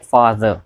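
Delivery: "father" drawn out slowly, long aa; slow demo father. Shown as a demonstration. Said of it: "followed by श्वा". ("father" धीरे-धीरे, लंबे आ के साथ)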